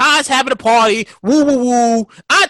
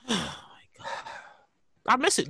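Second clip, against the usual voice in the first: normal talking voice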